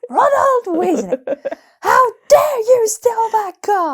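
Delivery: high, slow, loud howler scolding; Mrs Weasley. Acted. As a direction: screech it like a furious mother's howler. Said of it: "Weasley's got a howler".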